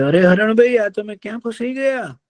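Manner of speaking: high, concerned crow's voice; a caring crow finding his friend trapped; worried crow. Acted. Crow worried about trapped deer. (ચિંતિત કાગડાનો ઊંચો અવાજ)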